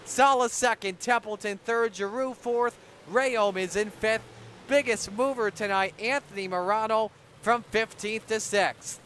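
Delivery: excited race call, fast and loud; play-by-play. Acted. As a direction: call the checkered flag live. Live race call finish.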